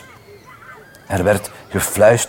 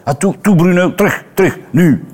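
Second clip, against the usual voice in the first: Stella calling dog; 7 semitones higher than usual. A narrator's reading. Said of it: "zei Stella".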